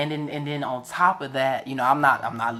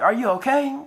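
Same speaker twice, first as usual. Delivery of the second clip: much higher, gentle imitation of the woman asking; concerned caller; 10 semitones higher than usual. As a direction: mimic her worried check-in, pitched up.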